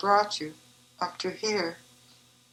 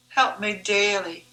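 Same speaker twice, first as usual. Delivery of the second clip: usual speaking voice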